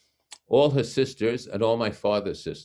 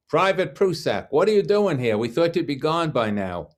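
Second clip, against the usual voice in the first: loud, high yelling across the room; retold yell; 9 semitones higher, 6 dB louder than usual. Loudmouth friend yelling across the cafeteria, loud high shout. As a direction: call out loudly to a friend walking in. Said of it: "yelled out".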